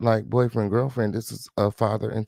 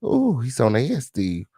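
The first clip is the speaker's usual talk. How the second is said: ordinary talk, nothing marked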